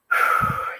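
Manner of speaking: unvoiced rush of breath; emotional sigh. Real, not acted. Touched by a son's message, emotional sigh. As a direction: blow out a real, moved sigh.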